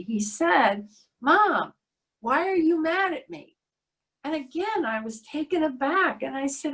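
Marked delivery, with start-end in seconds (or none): 1.2-3.6 put-on higher-pitched, insistent child's plea; urgent child. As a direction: lightly imitate a small boy pressing his mom for an answer. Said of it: "with much more urgency".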